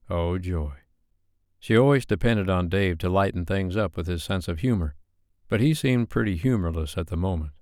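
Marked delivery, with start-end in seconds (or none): none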